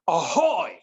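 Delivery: ahoy with some fire; committed ahoy. Retold or imitated. Waiter giving the manager an ahoy with fire. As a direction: give it some oomph. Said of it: "That's what I'm looking for".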